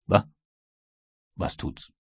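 almost voiceless sneer, dismissive and unbothered; contemptuous. Acted. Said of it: "lächelte nur verächtlich und sagte".

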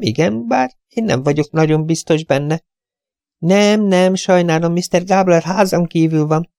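put-on high, light voice of a young woman on the phone; chirpy office girl, a bit scatterbrained; young receptionist. Young receptionist answering callers on the phone, high and chirpy.

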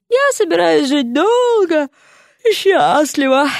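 high, slow undead drawl; smiling lazy zombie. A narrator's reading. Дерек, зомби, улыбаясь.

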